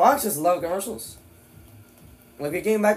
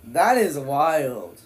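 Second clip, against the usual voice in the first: slow, drawn-out amazement; stunned, emphatic slow verdict; amazed; much slower than usual. Real, not acted. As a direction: stretch each word out in astonishment. Reacts to the shocking headline.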